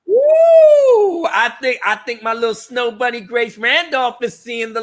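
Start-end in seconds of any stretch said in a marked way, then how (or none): 0.1-1.2 big high loud cheer; joyful shout; excited whoop. Real, not acted. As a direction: whoop in triumph. Cheering Grace's boycott video.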